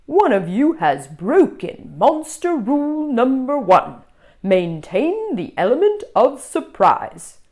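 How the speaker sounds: stern monster teacher reciting rules. Acted. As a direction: recite the rule like a strict teacher.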